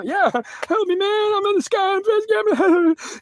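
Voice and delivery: very high, strained cry for help; hammed-up wailing plea; panicked begging. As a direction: act out sheer terror, voice shooting way up. Acted panic in crashing helicopter.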